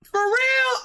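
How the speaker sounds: very high disbelieving question; incredulous. Real, not acted. Reacting to the video, high incredulous squeak.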